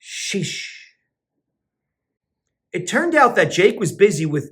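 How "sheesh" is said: ordinary delivery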